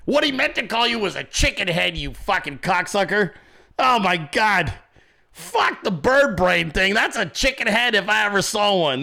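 ordinary talk, nothing marked

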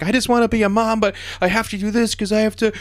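high, hammed-up tearful plea; overacted weepy diva. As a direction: pitch it way up like a sobbing star. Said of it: "and she's like".

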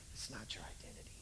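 soft whispered reassurance. Acted. Jesus soothes a grieving believer, whispering. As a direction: whisper the truth gently.

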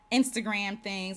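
ordinary delivery

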